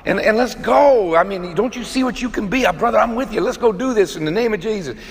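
mock pep talk from a fellow man; rousing brotherly push. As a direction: fire a brother up to get going. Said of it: "men want men to go".

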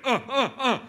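high, loud wordless grunts of uh, laughing through them; mock a kid pointing and whining; imitating toddler grunts. Father mimicking his wordless kid, high grunting. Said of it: "he's just like".

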